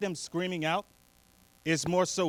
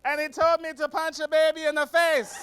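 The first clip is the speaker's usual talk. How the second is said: crazy train rider; 8 semitones higher than usual. Retold or imitated. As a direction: proclaim it to the whole car, pitched up. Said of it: "here he goes".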